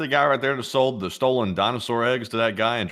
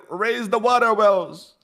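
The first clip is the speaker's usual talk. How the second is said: high, slow mock command; hammed-up leader ordering wells; 10 semitones higher, 3 dB louder, slower than usual. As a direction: bark it like a pompous leader. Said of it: "I said".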